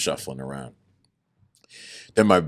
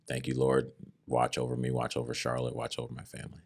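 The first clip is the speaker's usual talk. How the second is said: soft, fading prayer to the Lord, growing quieter; hushed prayer; 3 semitones lower, 8 dB quieter than usual. Retold or imitated. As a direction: murmur it on your knees, trailing away. Prayer in jail cell.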